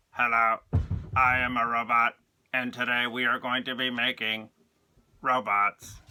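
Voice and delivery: loud, flat robot voice from the throat. Shown as a demonstration. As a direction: talk like a cartoon robot. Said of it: "try and talk from the back of your throat".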